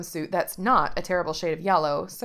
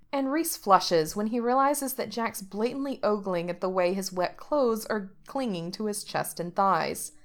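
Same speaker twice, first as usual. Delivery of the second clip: usual speaking voice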